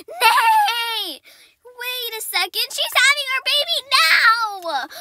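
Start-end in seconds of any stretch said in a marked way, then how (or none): 0.1-1.2 high, shouted "nay" with laughter; whinny loudly like a distressed horse; loud horse neigh. Pretend. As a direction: kid voicing the mare, shouting. Voicing the horse in labour.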